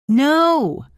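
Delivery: loud, high shout of refusal; a full-throated crowd cry; pirates shouting. A narrator's reading. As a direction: yell NO as a rowdy crew. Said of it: "the pirates shouted".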